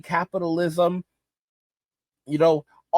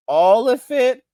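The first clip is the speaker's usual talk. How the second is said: pressing again, pitch up high; repeated incredulous question; 7 semitones higher, 5 dB louder, slower than usual. Pretend. Pressing the boomer again, higher incredulous demand. Mock exchange with greedy boomer.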